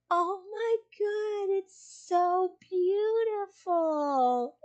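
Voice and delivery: high, very slow, swooning voice; dreamy sleepy friend. Retold or imitated. Sleep-deprived friend admiring the cucumber photo, high and drawn out. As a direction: gush over it like it's a masterpiece, way up high. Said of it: "and she goes".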